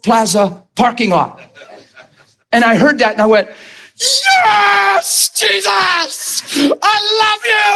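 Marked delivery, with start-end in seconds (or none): 4.0-7.7 joyful scream. Retold or imitated. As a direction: cry out to Jesus with all you've got. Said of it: "And I was screaming".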